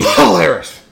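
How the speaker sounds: sudden loud blast into the mic; blurted loudly, too close to the mic; loud burst. Real, not acted. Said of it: "blow out my mic some more".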